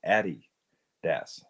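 "Adidas" very slowly as AD-ee-dahss; first-syllable stress. Shown as a demonstration. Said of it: "emphasis on the first syllable".

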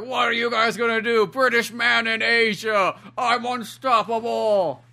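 high, slow mocking swagger; arrogant British fighter. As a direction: gloat slowly, fully sure of yourself. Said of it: "He's like".

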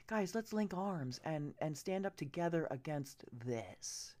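ordinary delivery

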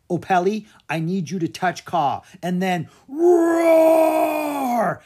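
high, loud call, stretched on roar; boy urging girl to make her dragon roar; urgent shout. Acted. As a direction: shout the order over the chaos. Drake urges Opeli in danger.

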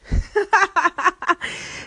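high, loud burst of laughter; real high-pitched cackle of amusement; laughing. Real, not acted. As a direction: laugh out in a string of high ha-has. Caller laughing at host's accents.

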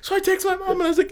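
very high squeaky voice, near tears; real laughing fit. Real, not acted. Telling co-host the spam call was real, helpless laughter. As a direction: push words out through helpless laughter. Cracking up at the story's reveal.

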